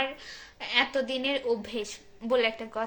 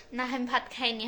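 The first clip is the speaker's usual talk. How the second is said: ordinary talk, nothing marked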